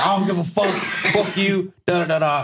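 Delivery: high, hot-headed mock rant; high-pitched retold outburst; retold wilding out. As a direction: act out flying off the handle, voice way up. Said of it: "just wilding out".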